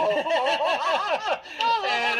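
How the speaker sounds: a high, amused laugh. Real, not acted. Laughing at his own story.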